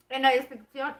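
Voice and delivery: talking while eating; words round a bite of food; mouth full. Real, not acted. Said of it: "con los brackets no puedo hablar muy bien y al mismo tiempo comer".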